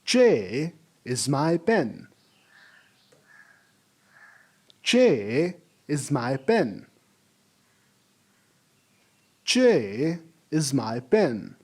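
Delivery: "Che" slow and falling, for the class to copy; repeat after me. Shown as a demonstration. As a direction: model "Che" slowly, falling tone. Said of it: "repeat it after me".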